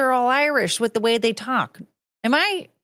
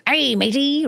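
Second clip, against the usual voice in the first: pirate impression; 5 semitones higher than usual. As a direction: hail a shipmate, half-played. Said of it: "they talk like that".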